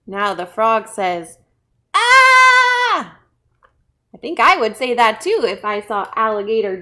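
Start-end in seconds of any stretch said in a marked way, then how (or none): 1.9-3.2 high, loud, long shriek; freaked-out scream. Acted. Frog saw alligator jaws, freaked-out scream. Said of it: "like freaking out".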